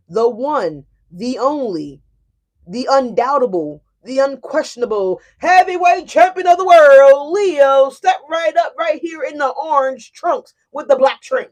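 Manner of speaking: loud, high hammed-up boxing announcer; ring announcer. Reader introducing Leo as the champion, loud ring announcer.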